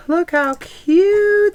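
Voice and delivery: high, slow, gushing over how cute they are. Real, not acted. Crafter showing off candles to viewers, slow delighted gush.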